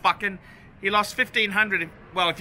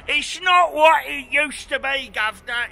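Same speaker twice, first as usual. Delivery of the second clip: hammed-up cockney accent, louder and higher; a cheeky cockney voice grumbling that England is not what it used to be; mock cockney accent; 5 semitones higher, 5 dB louder than usual. Mock Englishman ending "governor.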